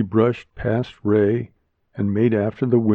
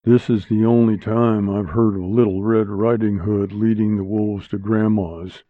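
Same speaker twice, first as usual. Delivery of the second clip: usual speaking voice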